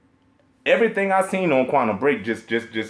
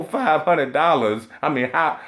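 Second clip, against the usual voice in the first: normal talking voice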